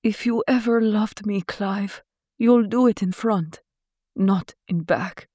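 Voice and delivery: lightly voiced glamorous heroine; pleading heroine. A narrator's reading. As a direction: bargain with the man who loved her. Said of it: "Laura said".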